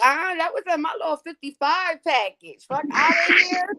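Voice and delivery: high, offended comeback; high mock indignation; indignant retort. Her own retort, quoted.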